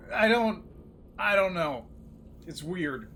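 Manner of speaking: talking while crunching crackers. Real, not acted. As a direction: chew crackers between words. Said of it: "don't mind me crunching on some crackers".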